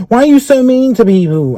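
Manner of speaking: high, put-on voice of the girl; high mock girl's voice. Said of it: "she's like".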